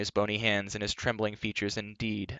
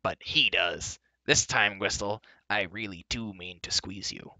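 rough dwarf threat. Acted. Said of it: "roughly seizing".

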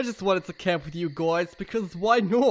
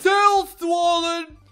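high, slow, loud call; 11 semitones higher, 6 dB louder, much slower than usual. Acted. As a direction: holler it out, stretching each word. Calling out the names.

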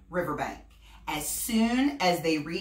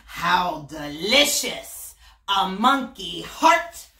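slow, louder greedy crocodile; 7 dB louder, slower than usual. Acted. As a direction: savour the idea of a monkey heart. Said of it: "murmured hungry Crocodile".

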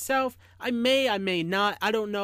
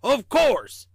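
gruff little train, raised a touch; small, gruff storybook voice; 4 semitones higher, 6 dB louder than usual. A narrator's reading. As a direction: answer firmly in a small rough voice. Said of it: "gruff voice".

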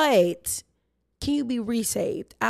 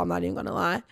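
ordinary talk

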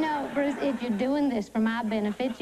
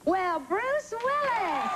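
slow, high swoon on "Well, Bruce Willis"; 7 semitones higher, much slower than usual. Acted. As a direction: draw out "Willis" in delight. Swooning greeting at the door.

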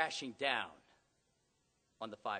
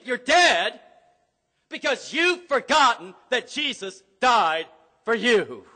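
starts firm and climbs to a shout; rising from stern warning to a full preaching shout; building rebuke; 6 semitones higher, 12 dB louder than usual. Acted. Preacher voicing Paul's rebuke to the church, building to a shout.

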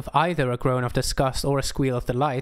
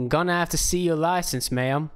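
a light hint of a gruff country cop; lightly voiced small-town cop asking for papers; mustached cop; 5 semitones higher than usual. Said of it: "a voice that implied he had a two-inch thick mustache".

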